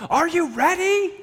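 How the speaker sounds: high yelled call out the car window; yelling from the car. Retold or imitated. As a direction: yell it to the family inside the house. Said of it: "roll down the window and yell".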